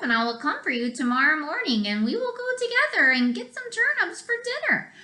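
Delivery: fast, rising eager wolf; sly wolf. Acted. Wolf offers to fetch the pig, fast and eager. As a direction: rush the plan out eagerly.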